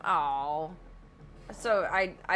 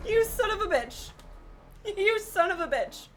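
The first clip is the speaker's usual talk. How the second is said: higher, louder cursing at the game; exasperated yell; 13 semitones higher, 4 dB louder than usual. Real, not acted. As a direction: curse the game out, half laughing. Keeps yelling at the game.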